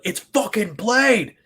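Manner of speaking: high, drawn-out fanboy yell; losing it with excitement, stretching every word; excited shout. Retold or imitated. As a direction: belt it out slowly, way up high. Retold outburst on recognizing the voice.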